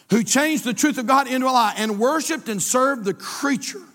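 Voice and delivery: loud pulpit reading; preaching. Acted. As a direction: proclaim it to the congregation.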